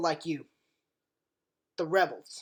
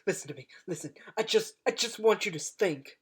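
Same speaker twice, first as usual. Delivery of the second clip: ordinary delivery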